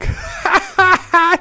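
sudden high laugh at his own slip; burst of high laughter. Real, not acted. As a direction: laugh out loud at yourself. Se equivoca y se ríe. (risa aguda por su propio error)

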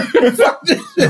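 high, laughing fuck-this; laughing outburst. Real, not acted. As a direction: blurt it out while laughing. Laughing walkout joke.

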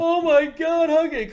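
high, loud wail of mock horror; horrified cry. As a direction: wail it like a devastated cartoon kid. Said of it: "And he's, like, crying".

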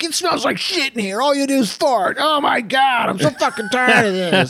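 high mock rant of a fed-up wife, shouted at the end; husband imitating his wife's angry complaint in bed. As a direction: rant like the wife blaming her husband for the smell. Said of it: "she's like".

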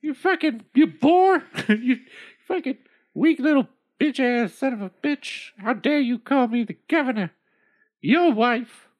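high, loud scolding rant; furious old woman. Acted. Old woman screaming at her husband, high and furious. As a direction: tear into him like an angry shrew.

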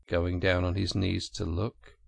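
plain reading voice

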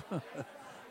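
deep, smug evil laugh; low villain chuckle. Acted. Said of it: "Klaus Schwab".

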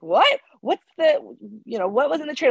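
a boss caught off guard, wanting details; surprised boss. Retold or imitated. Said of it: "He's like".

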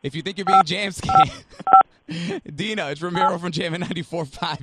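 big loud gotcha announcement; loud reveal. Acted. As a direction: shout the punchline. Prank reveal.

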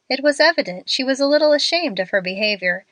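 plain reading voice

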